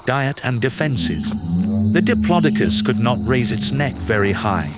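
usual speaking voice

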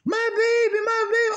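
high-pitched, loud mock wail; imitated crying wail. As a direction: cry out like a distraught mother. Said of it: "She steady fucking crying".

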